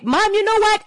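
put-on high, eager little girl voice rushing her news; bubbling over like a kid home from school; excited daughter. Mother imitating her daughter telling school drama, high and eager.

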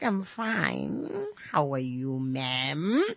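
a hint of the boss, low and hushed; imitating the boss. Boss politely answering the host's call, low and soft. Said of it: "He's like".

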